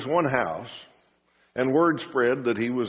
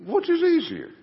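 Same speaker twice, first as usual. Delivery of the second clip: challenging. Acted. Jesus challenging the scribes.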